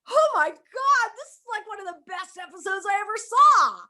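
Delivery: high, loud retold gush; delighted surprise. Actress reliving her delight at the episode, high excited exclamation. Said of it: "I was so surprised".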